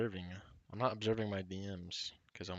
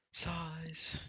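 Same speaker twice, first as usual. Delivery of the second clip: imitating the sister, a high sighed Size; mock sister sighing the word Size; sister's sighing size; 9 semitones higher than usual. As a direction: breathe out Size like a weary sigh. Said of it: "size in a sighing manner".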